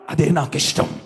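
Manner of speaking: sudden drop to a low register; solemn divine voice. Acted. Preacher voicing God's wish after the shout, low and solemn. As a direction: land it deep and settled.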